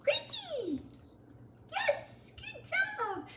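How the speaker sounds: high, bright call and praise; high puppy voice. Real, not acted. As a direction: call her name way up high.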